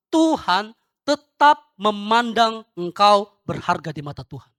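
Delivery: loud, slow, weighty declaration; emphatic preaching. Real, not acted. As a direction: proclaim it slowly and firmly, word by word. Pengkhotbah menegaskan pokok khotbahnya. (berkhotbah lantang dengan penuh penekanan)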